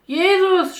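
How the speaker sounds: high, loud desperate call; piercing call for attention; shouted cry. A narrator's reading. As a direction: cry the name out over the crowd. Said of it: "schrie Bartimäus".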